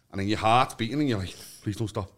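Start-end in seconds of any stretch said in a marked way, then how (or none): 1.6-2.1 low, soft pleading with himself; quiet retold plea. Pleading with his own beating heart, low and soft. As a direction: beg under your breath, half-joking.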